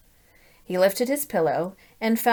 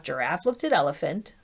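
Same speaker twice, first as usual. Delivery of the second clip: plain reading voice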